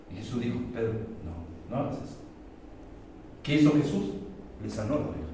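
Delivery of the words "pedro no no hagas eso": softer, calm word of correction; gentle restraint. Acted. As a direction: quietly hold Peter back. Jesus gently restrains Peter. (Jesús detiene a Pedro con suavidad, en voz baja)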